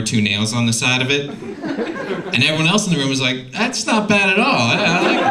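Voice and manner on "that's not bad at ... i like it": mock impressed approval. Adults in commercial approving.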